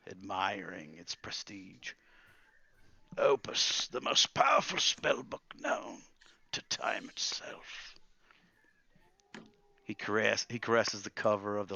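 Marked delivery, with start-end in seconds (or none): 3.2-7.9 high, forceful start fading to slow hush; awed old wizard. Acted. Old wizard admiring his legendary spellbook, awed. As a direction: reverent old wizard, savouring each word.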